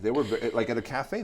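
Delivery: ordinary delivery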